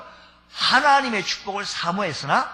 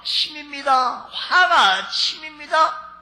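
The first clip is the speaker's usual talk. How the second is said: slow, rising, louder fury; building anger; 9 semitones higher, 6 dB louder, much slower than usual. A narrator's reading. Preacher depicting Esau's anger boiling up. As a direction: let the rage swell.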